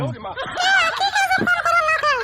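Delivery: high-pitched scolding wife voice; a wife nagging her husband to go shopping. Acted. Wife scolds husband about the in-law's visit, high and nagging.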